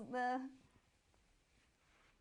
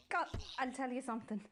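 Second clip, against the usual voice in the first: usual speaking voice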